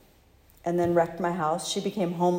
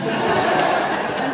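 a quick, airy burst of laughter; breathy laugh; 6 semitones higher, 4 dB louder than usual. Real, not acted. Laughs at her own joke.

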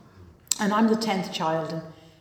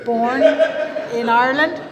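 BORN and IRELAND punched out louder; emphatic correction; 2 semitones higher, 7 dB louder than usual. Real, not acted. Answering people who doubt the Irish accent, emphatic "born in Ireland". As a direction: hammer "born" and "Ireland" with amused disbelief.

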